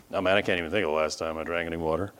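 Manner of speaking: low, soft, fast slacker reply; mock apathetic mumble; listless patient. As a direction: toss it off low and quick, can't be bothered. Acts out unhealthy patient answering doctor.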